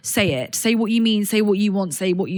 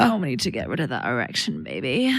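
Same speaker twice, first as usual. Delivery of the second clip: deep, soft seductive purr; sultry low voice; 9 semitones lower, 5 dB quieter than usual. Acted. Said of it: "make it sexy to say".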